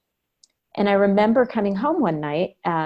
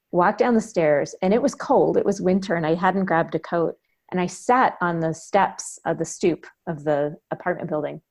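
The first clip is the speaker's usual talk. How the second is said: ordinary delivery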